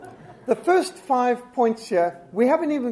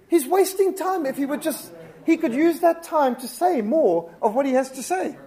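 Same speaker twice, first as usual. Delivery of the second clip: high, loud, fed-up complaint; exasperated; 7 semitones higher, 4 dB louder than usual. Real, not acted. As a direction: complain hotly about the waste. Exasperated at time-wasting speaker.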